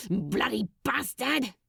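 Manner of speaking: mild hissed curse under breath. Acted. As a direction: spit the curse while dressing. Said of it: "he hissed".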